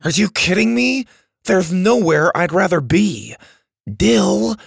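put-on high, thrilled, incredulous boy; excited scoff. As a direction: gush in disbelief at a friend. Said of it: "Max scoffed".

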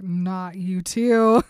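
loud, long-drawn-out dismay; exasperated groan. Acted. Bella dreads yet another dance invitation, mock exasperated. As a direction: drag it out, hammed-up exasperation.

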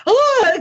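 high, loud mock scream; imitated startled scream. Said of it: "Luke and Rainer screamed".

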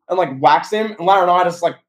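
usual speaking voice

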